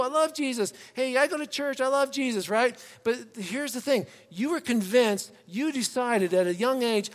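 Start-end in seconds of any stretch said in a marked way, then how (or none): 0.0-2.5 put-on child telling schoolmates. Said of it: "you would tell kids at school".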